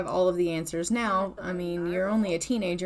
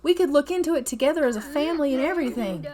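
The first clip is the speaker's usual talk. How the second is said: higher, eager dad voice; excited dad; 6 semitones higher, faster than usual. Acted. Dad plans with his son, getting all excited. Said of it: "he's getting all excited".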